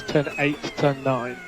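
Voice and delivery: normal talking voice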